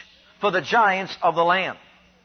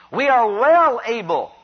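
"well" punched louder and stretched out; slow down and hit "well" with conviction; stress on well; 3 semitones higher, 3 dB louder than usual. Acted. Preacher repeats Caleb's confession, "well" stretched and punched.

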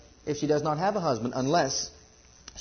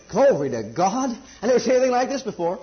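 amazed exclamation; 6 semitones higher, 5 dB louder than usual. Retold or imitated. Adam seeing woman for the first time, overjoyed. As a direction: burst out in awe at the sight. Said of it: "That's what he said".

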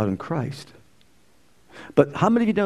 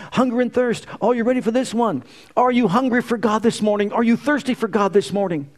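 higher, energetic call to the congregation; preacher stirring the crowd; rousing preaching; 8 semitones higher than usual. Acted. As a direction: lift them up with the question.

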